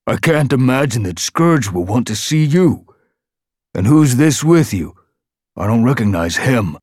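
high, loud mocking voice; a snorting guard scorning the visitors; contemptuous guard Bone. A narrator's reading.

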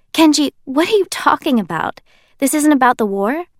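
lost in the conversation; confused. Acted. Narrator confused by Kenji.

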